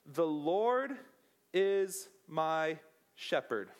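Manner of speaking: The Lord said slowly, low and solemn; slow solemn reading. Shown as a demonstration. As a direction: read it slow, like Scripture. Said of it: "it simply starts off like this".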